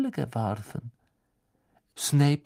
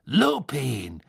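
louder, raised call of Lupin; 3 semitones higher, 4 dB louder than usual. Acted. Snape calling Lupin through the fireplace. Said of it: "rief Snape ins Feuer".

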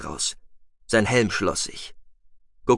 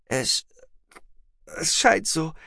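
halting, higher woman's voice; struggling with nausea; 7 semitones higher, slower than usual. A narrator's reading. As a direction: stammer it out between waves of nausea. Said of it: "Nur mit Mühe". (stockend, gegen Würgereiz ankämpfend)

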